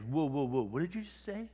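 astonished retold reaction. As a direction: act out someone stopped in their tracks. Retold actor stops Chris, astonished.

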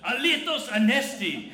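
loud, high, slow Alithos anesti; a Greek grandmother's loud, high, slow reply. Retold or imitated. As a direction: answer like a delighted Greek grandma. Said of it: "She goes".

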